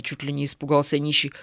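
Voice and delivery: ordinary delivery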